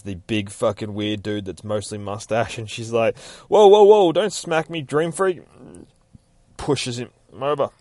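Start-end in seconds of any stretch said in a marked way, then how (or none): 3.5-5.4 startled yelp. Retold or imitated. Lucy startled in a dream.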